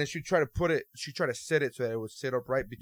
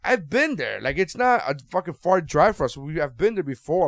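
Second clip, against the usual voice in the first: loud, high indignant reply, slowed down; retelling a heated answer to a pushy stranger, high and loud; indignant raised voice; 6 semitones higher, 9 dB louder than usual. Retold or imitated. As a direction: push back loudly, spelling it out.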